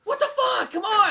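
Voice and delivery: high-pitched exasperated cry; mock outrage. Co-host ranting at the unimpressed son, high incredulous outburst.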